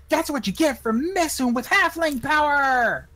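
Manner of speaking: high, loud triumphant shout; battle cry. Acted. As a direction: roar it as a victory boast. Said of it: "No, I'm sorry".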